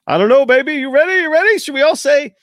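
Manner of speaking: high, loud hype-man call; hyped up. Real, not acted. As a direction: rev up the crowd. Host revving up for sign-off.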